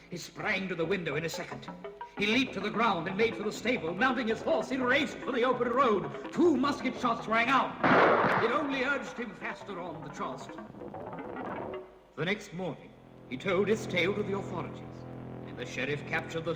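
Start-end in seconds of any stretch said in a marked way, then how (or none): none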